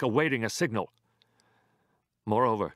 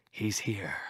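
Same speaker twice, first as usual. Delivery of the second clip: whisper; 2 semitones lower, 4 dB quieter than usual. Acted. Conspirator reports arrival in secret.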